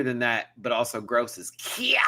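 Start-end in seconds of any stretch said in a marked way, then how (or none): 1.5-2.0 "kiak" in a high squawk, two sounds kee-ACK; high, exaggerated comic "kiak"; comic pronunciation. Shown as a demonstration. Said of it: "something about hearing it that kind of way".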